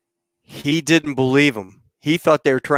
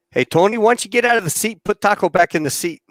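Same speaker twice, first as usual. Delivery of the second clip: higher-pitched retold request; casual order; 8 semitones higher than usual. Captain asking Tony to give up the seat, casual order. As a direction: call over to him in a raised pitch.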